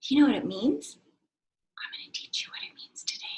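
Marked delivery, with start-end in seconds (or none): 1.8-3.2 fast, secret whisper to the class. Shown as a demonstration. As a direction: let them in on a secret, whispered.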